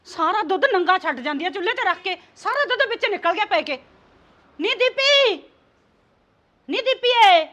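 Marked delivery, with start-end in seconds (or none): 4.6-5.4 loud call across the house. Acted. Mother-in-law calling her daughter-in-law, loud call. Mother-in-law calls Deepi over spilt milk. 6.7-7.4 a louder call, stretching the last ए. Acted. Mother-in-law calling Deepi a second time, louder impatient call.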